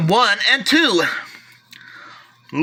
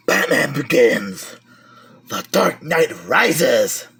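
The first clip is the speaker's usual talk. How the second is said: lower, slow, gruff-sounding title read; mock Batman voice; 6 semitones lower, slower than usual. Collector reads his Batman movies, mock dark hero voice. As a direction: growl the titles like a brooding hero.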